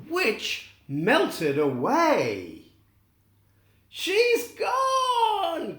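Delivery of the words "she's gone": high, excited cry; cry of joy. Acted. Dorothy crying out as the witch melts away, high and excited. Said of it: "cried Dorothy".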